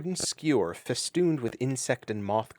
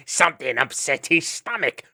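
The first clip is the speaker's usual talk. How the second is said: callous jeer; 4 semitones higher than usual. Acted. Vermin jeering at dying ferret.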